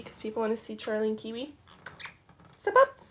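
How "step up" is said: bright high step-up call; high, loud bird command. Real, not acted.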